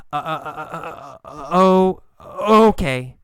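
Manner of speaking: stammering uh uh before okay; halting, unsure start and stop; hesitant. Shown as a demonstration. Teacher acting a student's unsure okay. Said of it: "She says it hesitantly".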